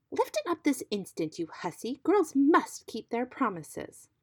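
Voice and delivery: high-pitched, imperious scolding; scolding command. A narrator's reading. Stepmother ordering the girl to obey the frog, scolding.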